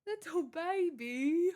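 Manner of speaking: high, slow pet voice. Real, not acted. Streamer coos at her cat, high slow baby talk.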